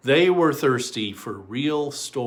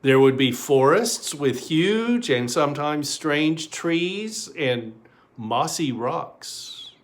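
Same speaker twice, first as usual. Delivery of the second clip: ordinary delivery, nothing marked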